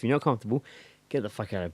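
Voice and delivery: normal talking voice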